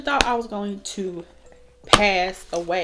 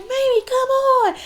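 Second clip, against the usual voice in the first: high, loud pleading brother voice; imitating brother; 4 dB louder than usual. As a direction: tug her along in a hammed-up voice. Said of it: "He was like".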